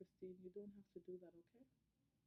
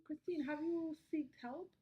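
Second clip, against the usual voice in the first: tearful, slow and higher; crying; 7 semitones higher, 6 dB louder, slower than usual. Acted. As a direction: choke out the words through tears. Patient breaks down crying.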